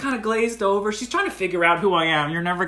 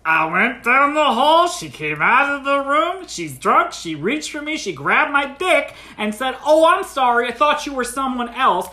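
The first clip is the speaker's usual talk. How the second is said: exasperated retelling; 5 semitones higher, 4 dB louder than usual. Retold or imitated. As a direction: punch out each short sentence, imitating the friend. Friend exasperatedly repeats his account.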